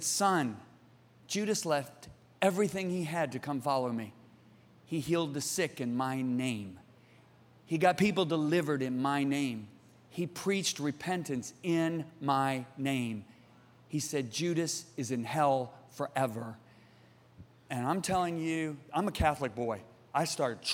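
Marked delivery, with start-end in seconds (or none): 0.0-13.2 low, soft, grave plea; pleading voice. Retold or imitated. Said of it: "he said it in a pleading voice". 14.3-16.6 low, soft, slow and solemn; pleading voice. Retold or imitated. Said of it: "he said it in a pleading voice".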